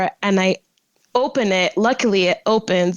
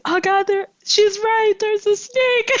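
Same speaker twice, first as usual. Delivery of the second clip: scared; 12 semitones higher than usual. Retold or imitated. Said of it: "he's like".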